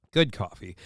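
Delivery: usual speaking voice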